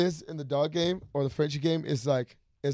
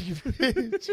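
a short, higher, mock-exasperated jab; mock exasperation; 7 semitones higher than usual. Reacting to Nick's confession, mock exasperated. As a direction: toss off the insult half-laughing.

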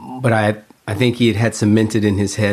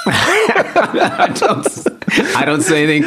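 laughing; 12 semitones higher, much slower than usual. Real, not acted. Laughing at freezing up.

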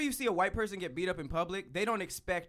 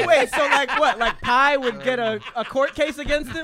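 loud, high voice full of laughter; high, loud, laughing riff; cracking up; 8 semitones higher, 10 dB louder than usual. Real, not acted. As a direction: barely get the joke out for laughing. Cracks up imagining absurd court case.

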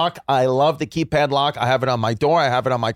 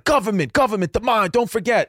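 high, hammed street preacher sign-off; parting rant; 7 semitones higher than usual. Ranter leaving the train, high parting shout. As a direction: fling it back high as the doors close. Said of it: "and was like".

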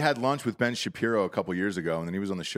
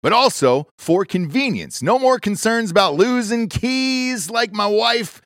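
loud, punchy ad read; 8 semitones higher, 12 dB louder than usual. Acted. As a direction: sell it hard like a pitchman.

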